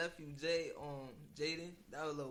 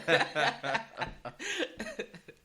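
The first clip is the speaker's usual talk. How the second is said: big burst of laughter; loud laugh; 8 semitones higher, 11 dB louder than usual. Real, not acted. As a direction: crack up loudly. Laughing on the podcast.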